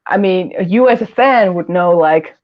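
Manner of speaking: ordinary delivery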